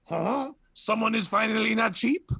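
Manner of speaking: sassy mock-French put-down; French accent. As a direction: answer sassily in a fake French accent. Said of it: "answer in a French accent".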